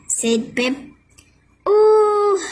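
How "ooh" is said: high, loud ooh of surprise; crowd gasp. A narrator's reading. Crowd sees the fuzz appear: high gasping ooh. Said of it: "the crowd gasped".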